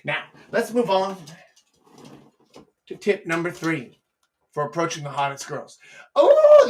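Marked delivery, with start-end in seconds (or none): 6.1-6.7 big high loud "oh"; excited whoop. Real, not acted. Host relishing a favorite tip, excited. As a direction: burst of delight.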